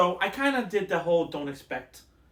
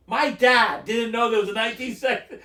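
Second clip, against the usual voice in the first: loud, raised-pitch disbelief; incredulous; 6 semitones higher, 7 dB louder than usual. Real, not acted. Telling a friend that even dad didn't know, incredulous. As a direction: exclaim it, astonished and louder. Amazed at dad's ignorance of original.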